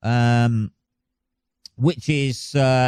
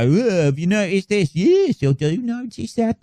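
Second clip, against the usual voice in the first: high-pitched, hammed-up Birmingham voice; exaggerated Birmingham mimicry; mock Birmingham accent; 7 semitones higher than usual. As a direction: take the piss in a high Brummie accent. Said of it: "I always do a Birmingham accent".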